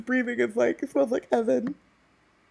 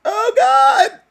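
laughing tears; 6 semitones higher, slower than usual. Real, not acted. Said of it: "Now I'm crying".

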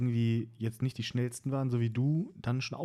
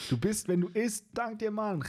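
mock sage voice; 10 semitones higher than usual. Mock philosopher's wisdom and thanks.

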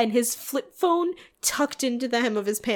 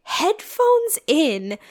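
higher and slower in disbelief; slow, much higher incredulity; incredulous rising pitch; 7 semitones higher, slower than usual. Real, not acted. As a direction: stretch it out, amazed. Incredulous retelling.

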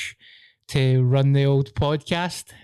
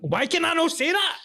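exasperated. Real, not acted. Host failing to say rhyming slang, exasperated. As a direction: pitch climbing to a high protest. Frustrated at own mispronunciation.

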